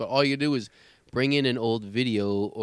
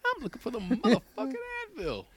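higher, cocky Jackson-style swearing; Samuel L. Jackson impression; 13 semitones higher than usual. Customer at the counter asking for Advil, cursing. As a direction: hit the swear word like Jackson. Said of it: "Samuel L. Jackson voice box".

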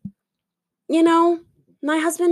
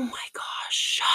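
whisper; 11 dB quieter than usual. Real, not acted.

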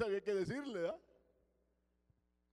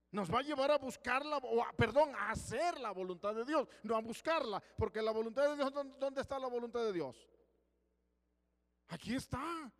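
louder, insistent preaching voice; fervent preaching; 5 semitones higher, 8 dB louder than usual. Acted. Preacher exhorting on God's will, loud and insistent. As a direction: press the point home.

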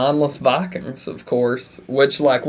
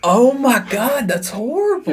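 shocked; 9 semitones higher than usual. Real, not acted. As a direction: recoil in mock horror. Shocked reaction.

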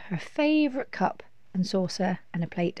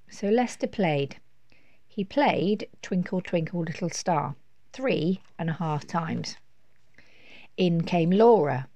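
ordinary delivery

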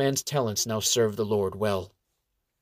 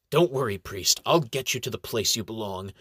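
ordinary delivery

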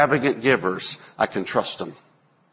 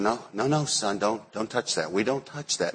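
soft, gentle no no no, much quieter; talking real soft; 7 dB quieter than usual. Retold or imitated. Father gently stopping his sensitive son touching something, soft. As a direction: coax the child softly, no harshness. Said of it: "I'd have to talk real soft".

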